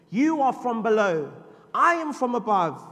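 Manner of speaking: loud, high ringing proclamation; preaching. Acted. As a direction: thunder it at the church. Preaching at full force.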